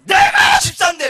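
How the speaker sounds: the deacon's affronted yell, mimicked; indignant outburst. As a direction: bellow it like an insulted deacon. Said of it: "막 그러면서".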